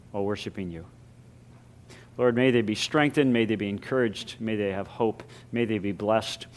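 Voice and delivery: hushed prayer voice. Real, not acted. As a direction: pray it quietly and reverently. Pastoral prayer before sermon.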